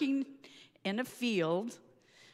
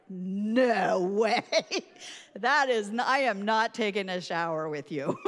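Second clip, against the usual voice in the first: louder, laughing no way; loud refusal; 6 dB louder than usual. Retold or imitated. Refusing to shower with her friend, loud and laughing. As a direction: protest loud through laughter.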